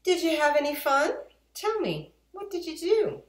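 high, loud, quick cheerful question; mother asks eagerly; bright mother. Acted. Mother greets the children coming home, brightly. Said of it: "she said to us two".

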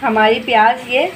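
ordinary talk, nothing marked

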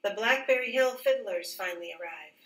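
ordinary delivery

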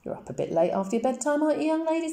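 gently mock a child up past bedtime; teasing policeman. A narrator's reading.

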